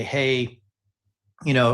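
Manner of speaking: ordinary talk, nothing marked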